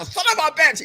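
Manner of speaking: high-pitched mock commentator outrage. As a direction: cry it out high like an outraged ringside announcer. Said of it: "channeling his inner J R".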